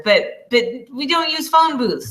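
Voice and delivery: ordinary delivery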